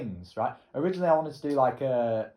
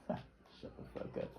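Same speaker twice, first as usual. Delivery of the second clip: whispered through a laugh; breathy laughing put-down; laughing whisper to himself; 15 dB quieter, slower than usual. Real, not acted.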